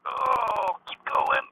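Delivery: straining under a heavy weight; strained push while lifting; carrying heavy load. Real, not acted. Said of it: "that's way too heavy".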